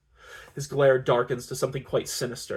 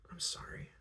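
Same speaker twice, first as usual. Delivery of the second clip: very soft, whispered apology; 16 dB quieter than usual. A narrator's reading. Woman apologising once her breath has returned, whispering. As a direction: whisper it with hardly any voice. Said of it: "I whisper once my breath has returned".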